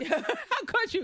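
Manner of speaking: mock betrayed wail.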